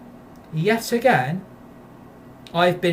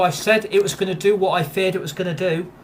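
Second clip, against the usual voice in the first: ordinary delivery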